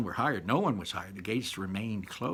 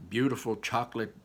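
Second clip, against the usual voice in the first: ordinary delivery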